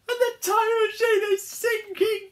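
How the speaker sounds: high, loud, slow wail; panicked cry. Acted. Harold sees the machine sinking, shrieked panic. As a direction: wail it in alarm. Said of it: "cried Harold".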